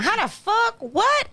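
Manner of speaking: high-pitched incredulous outburst; mock outrage. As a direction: voice jumps up in disbelief. Parent outraged at kids' freedom.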